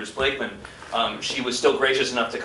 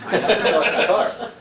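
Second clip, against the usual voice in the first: laughing through the line; 7 semitones higher, 4 dB louder than usual. Real, not acted. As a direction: chuckle while you say it. Laughing while claiming the role.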